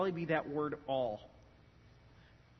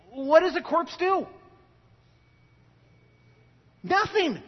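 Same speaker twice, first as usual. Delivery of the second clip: loud, high preaching at full force; fiery preaching; 6 semitones higher, 8 dB louder than usual. Acted. Pastor preaching loudly to his congregation, fiery. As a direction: preach it hard to the church.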